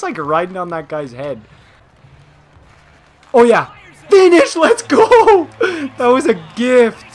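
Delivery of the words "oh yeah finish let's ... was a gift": laughing celebration. Real, not acted. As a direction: crow over an easy goal.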